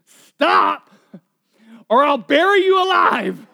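high loud shout breaking into a laugh. Retold or imitated. Counselor yelling threat at fearful client. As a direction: yell the threat, cracking up at it. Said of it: "He says".

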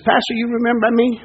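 retold question with a Southern lilt; Southern accent. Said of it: "he had a real Southern accent".

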